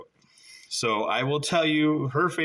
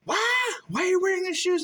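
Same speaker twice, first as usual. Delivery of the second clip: squeaky high disbelieving why; incredulous high. Retold or imitated. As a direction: shriek it in mock disbelief. Acting out beach quarrel with girlfriend.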